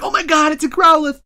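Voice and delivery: put-on high, loud burst of childlike delight; childlike glee. Player reliving finally finding Growlithe, childlike glee. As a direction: gasp it out like a thrilled kid spotting a rarity. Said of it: "I had that childlike glee".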